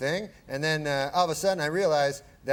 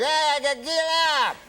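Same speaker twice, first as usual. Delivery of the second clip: Bill Cosby impression; 11 semitones higher than usual. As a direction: stretch it out high like Cosby. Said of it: "And he's like".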